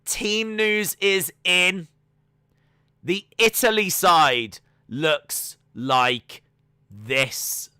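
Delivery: slow, drawn-out dramatic reveal; dramatic announcement. Acted. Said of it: "That was meant to be dramatic".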